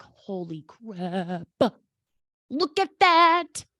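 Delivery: be floored by what you see; mock amazement at a bank balance; amazed gasp. Imagined amazed reaction to bank balance.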